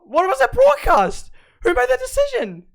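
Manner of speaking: high, loud shocked questions; stunned outburst. Real, not acted. Fan shocked by the pit decision, stunned outburst. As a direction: blurt it out, can't believe it.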